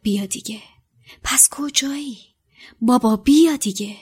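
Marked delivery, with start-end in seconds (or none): 0.0-0.6 whispered, barely voiced; muttered under breath. Harry urging his father to appear, in a whisper. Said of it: "زیر لب گفت". 1.2-2.2 a whispered, higher-pitched question; muttered under breath. Acted. Harry waiting for his father by the lake, whispering. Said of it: "زیر لب گفت".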